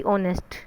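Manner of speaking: "ऑनेस्ट" with no h sound at the start; silent h. Shown as a demonstration. Teacher after spelling out the word, "ऑनेस्ट" with silent h. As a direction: start "ऑनेस्ट" on the vowel, dropping the h. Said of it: "बोलता नहीं है".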